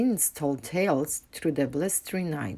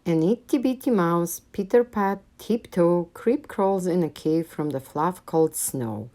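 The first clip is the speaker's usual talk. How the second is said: plain reading voice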